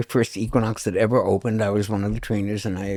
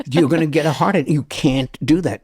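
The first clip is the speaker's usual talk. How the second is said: louder, higher mock scolding; acted alarmed warning; 6 semitones higher, 5 dB louder than usual. Trainers warning clients off the Atkins diet, mock alarm. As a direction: act out an insistent warning.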